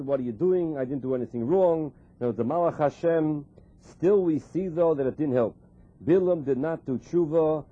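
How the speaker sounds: normal talking voice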